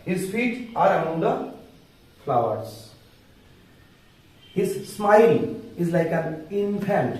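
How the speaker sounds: slow, word-by-word English with long pauses; speaking slowly. Shown as a demonstration. Teacher reading poem lines to students, slow and deliberate. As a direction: spell it out slowly so students can follow. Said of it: "আস্তে আস্তে বলছি".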